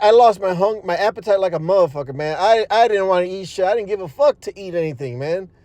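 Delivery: ordinary delivery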